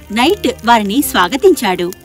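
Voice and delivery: usual speaking voice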